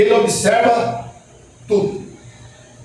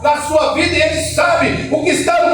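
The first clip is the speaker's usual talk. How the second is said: preaching at full force; 5 semitones higher than usual. Acted. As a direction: shout the truth to the congregation. Preaching climax.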